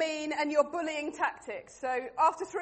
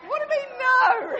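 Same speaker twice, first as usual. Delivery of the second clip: high, louder outraged comeback; mock indignation; 9 semitones higher, 9 dB louder than usual. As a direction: feign being affronted by their answer. Mock indignation at audience's answer.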